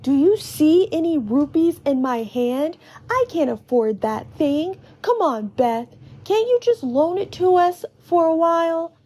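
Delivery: protest, then wheedle a friend; high-pitched child begging a favour; Tallow, pleading. A narrator's reading. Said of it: "Tallow says".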